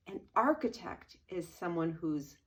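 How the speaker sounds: usual speaking voice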